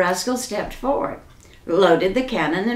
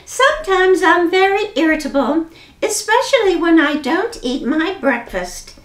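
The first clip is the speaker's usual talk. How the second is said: 1 prim rascal; 8 semitones higher than usual. A narrator's reading. Prim rascal answers pirate.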